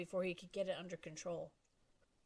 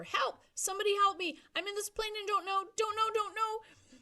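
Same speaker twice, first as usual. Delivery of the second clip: loud, much higher screams for help; panicked screaming; 14 semitones higher, 9 dB louder than usual. Acted. Stranded boy screaming into the plane's microphone, panicked. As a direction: scream it, losing control. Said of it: "he began to scream into the microphone".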